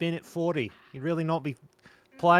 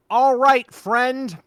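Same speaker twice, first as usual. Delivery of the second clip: character voice; 7 semitones higher, 5 dB louder than usual. Acted. S0 does a character voice.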